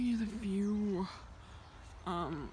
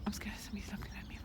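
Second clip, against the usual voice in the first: fast, soft scared whisper; nervous whisper; 8 dB quieter, faster than usual. Real, not acted. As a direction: rush it out hushed. Nervous on strangers' land.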